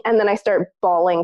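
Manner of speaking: ordinary talk, nothing marked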